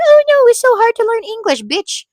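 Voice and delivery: high, loud mock wail; mock whining. Imitating people who say English is hard, mock whining. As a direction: whine it like a helpless complainer. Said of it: "why people are like".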